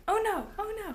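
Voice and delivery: put-on high sing-song baby oh no; toddler imitation. Mom imitating her son's little oh no, high. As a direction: copy a toddler lightly. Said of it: "he goes".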